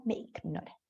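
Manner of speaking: soft, low "ignora" with a K sound; quietly harden "ignora" to "ik-nora"; ultracorrected G. Shown as a demonstration. Teacher echoes the typical error, quiet overcorrected "ignora" with K. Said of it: "exagera mucho algún fonema".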